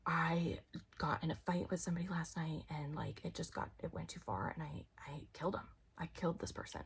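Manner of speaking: hushed, breathy mock confession. Joking to grandparents about a crime, whispered. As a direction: whisper it like a guilty secret.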